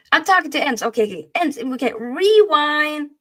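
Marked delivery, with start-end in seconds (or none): 1.9-3.1 loud, stretched-out call; drawn-out rewind. Pretend. Host restarting an interrupted bit, loudly drawn out. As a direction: hold the word long and loud.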